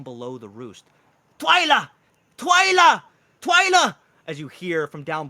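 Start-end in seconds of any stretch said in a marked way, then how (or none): 1.4-3.9 high, loud shout of a name; calling out. Acted. Mushroom man calls up to Twyla from below the roost, hollering. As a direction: holler up to someone in a treehouse.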